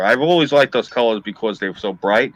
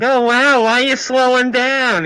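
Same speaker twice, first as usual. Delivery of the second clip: very high, louder, oblivious female voice; clueless woman impression; 12 semitones higher, 4 dB louder than usual. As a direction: play her as a high-voiced airhead. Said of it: "this moron is sitting there like".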